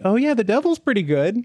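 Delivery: suck-up voice. Retold or imitated. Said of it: "The suck up".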